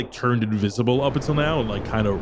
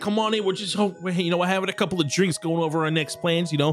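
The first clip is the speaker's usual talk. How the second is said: lightly higher, quick chatty voice; friendly gnome; 6 semitones higher, faster than usual. Acted. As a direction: chatter on eagerly. Voices a tavern patron.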